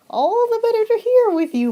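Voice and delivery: high squeaky fake-granny voice; the wolf in Grandma's bed answers Red in a high fake-granny voice. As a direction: pitch it up to pass as Grandma.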